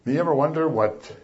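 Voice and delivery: usual speaking voice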